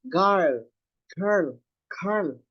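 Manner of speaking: put-on "Girl" said like gal, with an open a; A-like vowel. Shown as a demonstration. Teacher shows the class how it sounds, "girl" like gal. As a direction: repeat "girl" separately, vowel turned to a. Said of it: "Parang A".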